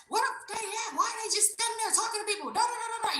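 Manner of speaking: high-pitched mock yelling; high, hammed-up complaining that runs into babble; yelling in background. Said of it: "You hear him yelling in the background".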